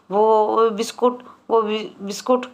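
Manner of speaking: faltering, tripping over the words; a frightened wife stumbling over the word; stammering in shock. A narrator's reading. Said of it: "लड़खड़ाती हुई जुबान से". (लड़खड़ाती ज़ुबान से, हकलाते हुए)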